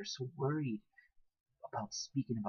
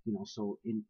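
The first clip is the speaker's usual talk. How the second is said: ordinary talk, nothing marked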